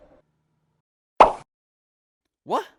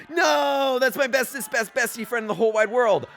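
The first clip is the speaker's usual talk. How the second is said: higher dismayed cry; 5 semitones higher, 3 dB louder than usual. Acted. Hero despairs over friend.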